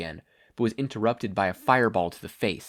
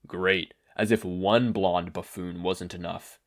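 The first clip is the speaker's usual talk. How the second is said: slow, lower, contemptuous scoff; 4 semitones lower, slower than usual. A narrator's reading. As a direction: sneer it out slowly. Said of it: "Endeavor scoffed".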